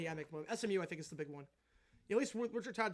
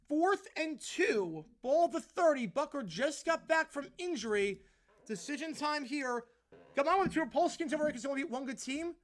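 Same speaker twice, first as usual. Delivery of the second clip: slow, raised-pitch announcer setup of a big down; play-by-play call; 5 semitones higher, 6 dB louder than usual. Acted. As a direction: build tension like a broadcaster. Play-by-play on fourth down.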